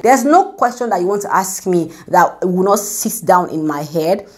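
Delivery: ordinary talk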